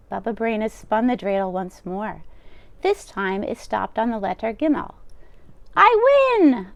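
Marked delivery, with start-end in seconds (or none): 5.8-6.7 much higher, louder gleeful cry; triumphant old woman. Acted. Old baba crowing over her dreidel win. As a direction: crow with delight at winning.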